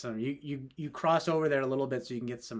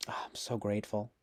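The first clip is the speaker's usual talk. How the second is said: whispering; 3 semitones lower than usual. Pretend. Whispered aside.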